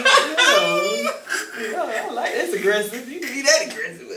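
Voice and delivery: high, loud laughing talk; tickled, words breaking into laughs. Real, not acted. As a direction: talk through a burst of laughter. Joking about intimate roughness, laughing.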